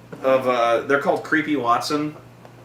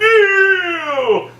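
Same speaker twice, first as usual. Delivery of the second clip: long, high bellow that falls away; melodramatic villain cry; 14 semitones higher, 4 dB louder than usual. Acted. Imagined Zod's big cry, melodramatic bellow. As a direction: wail it in mock despair.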